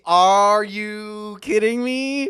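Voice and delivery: slow incredulous question; disbelief. Acted. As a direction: stretch it out in disbelief. Incredulous at starting mana.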